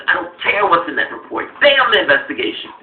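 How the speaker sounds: loud, hammed-up zealot rant; imitating a fervent pundit, loud; mock zealot rant. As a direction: rant it like a true believer. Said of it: "and now it's".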